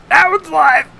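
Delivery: high, loud triumphant call-out; acted shout. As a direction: call it out after the zap. Electrocuted electrician calls out result.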